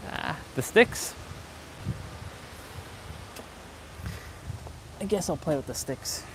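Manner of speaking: soft, half-whispered to self; quiet muttering. Real, not acted. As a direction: mutter it under your breath. Quiet muttering while playing.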